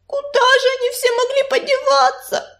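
high-pitched plaintive wail; lamenting. A narrator's reading. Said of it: "запричитала Белочка". (высокое жалобное причитание)

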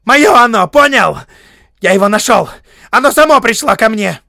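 high, very loud angry claims; possessive shouting. A narrator's reading. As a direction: shout in short furious bursts. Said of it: "вскрикнул он".

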